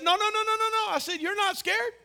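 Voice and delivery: high-pitched rapid no no no; dad's high insistent no; high protesting no. Retold or imitated. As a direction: cut her fear off high and quick. Dad correcting scared daughter again.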